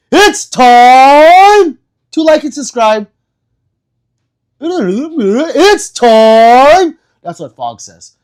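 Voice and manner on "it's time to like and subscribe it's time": much higher, much louder, slow stretched announcer call; hype announcer call. Pretend. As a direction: belt it out like a ring announcer. Hyping like and subscribe.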